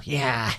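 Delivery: triumphant whoop into a cackle; villainous cheer. Acted. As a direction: gloat like raiders seizing a castle. Slurkworms seize the castle.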